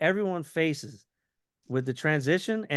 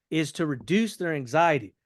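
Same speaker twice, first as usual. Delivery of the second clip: normal talking voice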